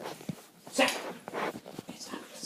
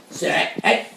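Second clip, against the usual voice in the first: ordinary delivery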